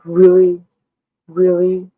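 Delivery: sarcastic cop. Retold or imitated. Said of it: "But he was like".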